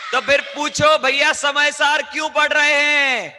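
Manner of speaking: high, loud, drawn-out call to the class; rousing shout. Real, not acted. Teacher prodding pupils to ask the question, rousing shout. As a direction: shout it out slowly to get the class asking.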